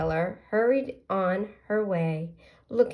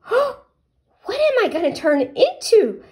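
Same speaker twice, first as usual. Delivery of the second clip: much higher, louder eager little pollywog; 10 dB louder than usual. Acted. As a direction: burst out the question.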